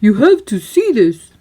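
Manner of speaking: goofy, eager cartoon voice. A narrator's reading. Goofy urging Mickey to come see, goofy and eager. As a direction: urge him to come, all excited.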